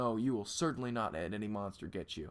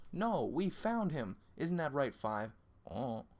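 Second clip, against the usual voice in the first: ordinary talk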